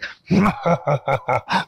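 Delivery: big evil monster cackle; menacing drawn-out villain laugh. Acted. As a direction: laugh like a horror serial monster. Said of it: "may biglang monster na dumating".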